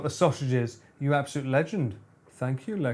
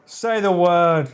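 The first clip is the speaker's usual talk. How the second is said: insistent; 4 semitones higher, 7 dB louder, slower than usual. Real, not acted. Demanding the AI say a swear.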